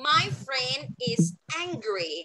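slow, word-by-word "My friend is angry"; slow model sentence. Shown as a demonstration. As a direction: model "My friend is angry" slowly for learners to repeat. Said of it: "พูดตามมิสเอมมานะคะ". (พูดช้า ๆ ทีละคำให้พูดตาม)

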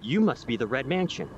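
ordinary delivery

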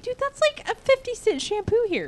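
high, soft disbelief; incredulous. Retold or imitated. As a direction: scoff in disbelief. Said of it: "I'm like".

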